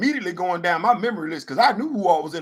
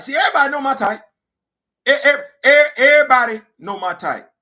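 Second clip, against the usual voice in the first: loud punchline; 6 semitones higher, 9 dB louder than usual. Acted. Punchline delivery to crowd.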